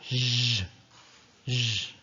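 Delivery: long buzzing "zh" with no "d" before it; soft French zh. Shown as a demonstration. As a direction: hold "zh", low and sustained. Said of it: "It's zh".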